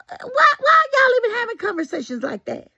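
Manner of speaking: high, crying-out disbelief; exasperated outcry. Real, not acted. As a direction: wail it in outraged disbelief. Outraged exclamation at gossip.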